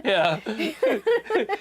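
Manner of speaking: high, quick words breaking up with laughter; laughing hard. Real, not acted. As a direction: talk through real laughter. Cracking up at a joke.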